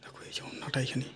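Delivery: disgusted hushed whisper. Acted. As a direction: whisper while hiding. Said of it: "আস্তে কথা বলছি।".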